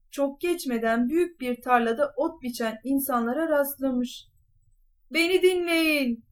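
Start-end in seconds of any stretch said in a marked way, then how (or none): 5.1-6.2 higher-pitched call for attention; shouted command. A narrator's reading. Cat addressing people cutting grass, shouting a command. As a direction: call out to a field of workers. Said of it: "diye bağırmış".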